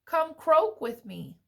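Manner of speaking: eager frog. A narrator's reading. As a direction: invite a pal as a cheery frog. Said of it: "said Frog".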